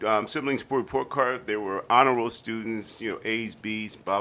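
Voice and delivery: ordinary talk, nothing marked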